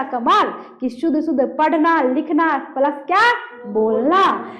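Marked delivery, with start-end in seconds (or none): none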